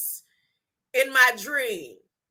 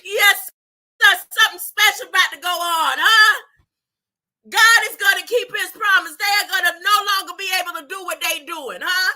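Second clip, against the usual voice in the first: fired up; 5 semitones higher, 5 dB louder, faster than usual. Real, not acted. Proclaiming God's promise to viewers.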